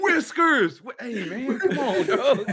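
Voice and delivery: high-pitched holler breaking into laughter; hammed-up yelp with laughter; laughing mock heckle. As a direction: call out high, then protest through laughs. Acts being yelled at with company.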